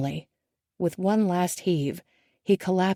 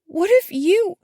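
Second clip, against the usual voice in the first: high, halting, horrified questions; forcing out a terrible thought; choking on the words; 8 semitones higher, 5 dB louder than usual. A narrator's reading. Daughter voiced by the narrator, choking on the words to her father. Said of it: "she chokes on the words".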